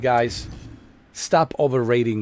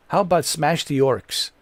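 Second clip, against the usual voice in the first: ordinary talk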